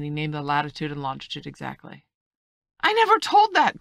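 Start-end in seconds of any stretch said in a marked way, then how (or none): 2.8-3.8 very high, loud exclamation of protest; indignant cry. A narrator's reading. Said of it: "cried the squire".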